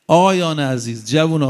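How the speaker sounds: ordinary talk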